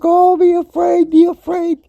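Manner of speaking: high, loud mocking doom voice; hammed-up be-afraid chant; mock panic. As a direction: parody the fearmongers. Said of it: "everybody's like".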